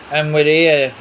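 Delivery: usual speaking voice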